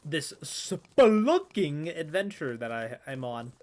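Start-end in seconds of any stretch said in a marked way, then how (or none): 0.4-1.9 "spelunking" drawn out slowly, loud in the middle; hammed-up, slow "spelunking"; savoured word. Shown as a demonstration. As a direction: stretch "spelunking" out with relish. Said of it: "it sounds so posh".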